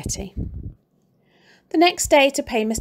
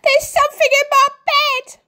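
panicked scream; 8 dB louder than usual. A narrator's reading. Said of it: "screamed Missus Twit".

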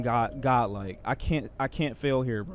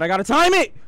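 high, loud urgent yell; panicked yell; 9 semitones higher, 8 dB louder, faster than usual. Real, not acted. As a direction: yell it in panic before the jump. Panicked repeat, much louder.

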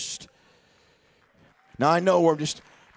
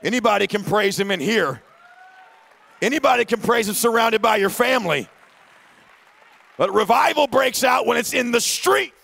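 building shout, higher and louder each line; preaching at full force; 8 semitones higher, 4 dB louder than usual. Acted. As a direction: lift the church up to the climax. Preaching on revival in streets.

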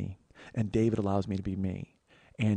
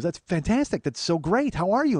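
high, louder burst of enthusiasm; delighted greeting; 7 semitones higher, 5 dB louder than usual. Retold or imitated. Friend hearing about the weight loss, delighted. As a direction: gush it, pitch leaping up on the key words.

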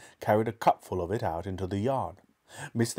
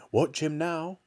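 a higher, mildly excited call; excited cry; 6 semitones higher than usual. A narrator's reading. Mister Cranky watching the cockerel grow, mildly excited cry. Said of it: "cried Mister Cranky".

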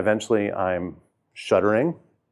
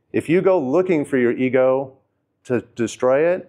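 ordinary delivery

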